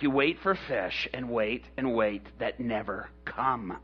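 low, soft deadpan demonstration; grudging condescension. Acted. Peter showing Jesus how fishing looks, low and condescending. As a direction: explain it wearily, humoring a know-nothing.

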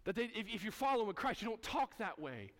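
normal talking voice

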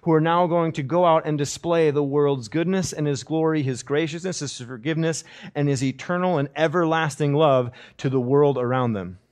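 loud, high, ringing sermon delivery; full-force preaching. Acted. Preacher proclaiming to the congregation, loud and ringing.

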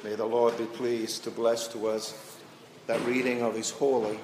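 measured, solemn Bible reading voice; preacher reading Genesis to the congregation, solemn and measured; scripture reading. Acted. Pastor reads Cain and Abel aloud, solemn.

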